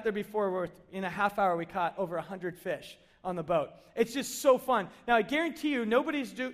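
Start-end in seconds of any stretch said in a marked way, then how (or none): none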